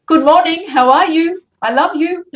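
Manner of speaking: put-on high, cheerful children's greeting; lightly voiced little kids. Village kids greeting the teacher in the street, lightly voiced. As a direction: chirp it like small pupils.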